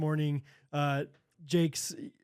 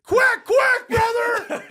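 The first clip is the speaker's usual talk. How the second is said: hyped yell; 10 dB louder, much slower than usual. Real, not acted. Hosts yelling joke catchphrase.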